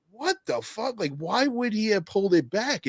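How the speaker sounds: high, incredulous mock outburst; retold disbelief. Retold own disbelief watching fight.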